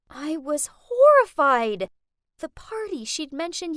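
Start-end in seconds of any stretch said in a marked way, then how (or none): 0.1-1.9 horrified. A narrator's reading. Mom is her maid.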